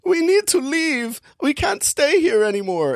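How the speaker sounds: very high boy's voice with a mock German accent. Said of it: "I don't know why I'm making him German".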